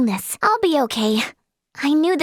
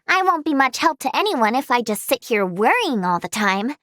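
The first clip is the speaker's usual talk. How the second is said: ordinary talk, nothing marked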